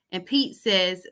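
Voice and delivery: normal talking voice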